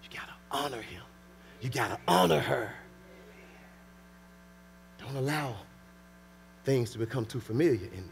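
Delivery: low, soft, earnest exhortation; hushed preaching. Real, not acted. Preacher urging couples to honor each other, hushed preaching. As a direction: bring the sermon down to a near murmur.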